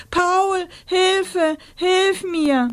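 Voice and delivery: very high, desperate calls; screaming for help. A narrator's reading. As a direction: call desperately for rescue. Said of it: "schrie Rosa".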